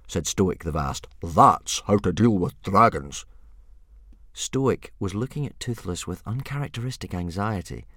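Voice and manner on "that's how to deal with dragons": smug chief. Acted. Stoic repeats smugly.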